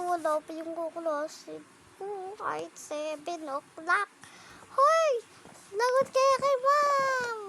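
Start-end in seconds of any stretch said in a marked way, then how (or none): 4.7-7.4 loud, high panicked yell; alarmed shout. Pretend. Alarmed, teacher is coming.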